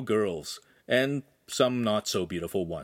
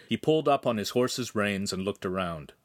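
ordinary delivery

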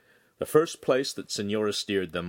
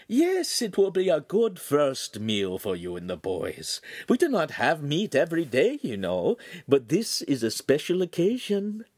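much higher, gently refusing lady; a refined lady politely declining; signora's voice; 7 semitones higher than usual. Acted. Signora turning down the butcher's beef heart, much higher.

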